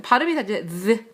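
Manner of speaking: ordinary talk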